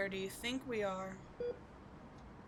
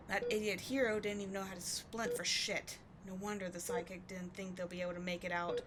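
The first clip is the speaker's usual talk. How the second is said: ordinary delivery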